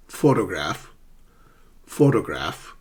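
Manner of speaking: "photograph" as PHO-to-graph; primary stress first. Shown as a demonstration. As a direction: punch PHO in "photograph", then let it fall. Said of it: "the strongest emphasis in a word".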